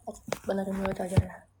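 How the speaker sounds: ordinary delivery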